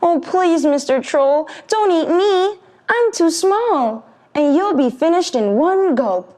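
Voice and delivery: high, pleading goat voice; pleading scared goat. Acted. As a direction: beg the troll not to eat him. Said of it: "trembled where he stood".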